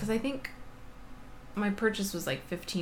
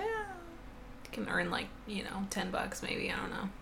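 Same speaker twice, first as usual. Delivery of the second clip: hushed; 2 semitones higher, 9 dB quieter than usual. Real, not acted. Soft aside about resale profit.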